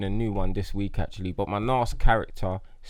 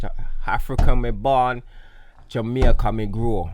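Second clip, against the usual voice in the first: put-on Jamaican accent; 3 semitones higher, 8 dB louder than usual. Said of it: "With a tad bit seasoning of Jamaica".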